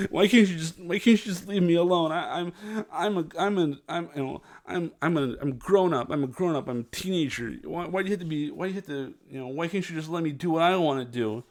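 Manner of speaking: put-on a sulky teen pleading to be left alone; whiny teenager. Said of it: "they whine and they bicker".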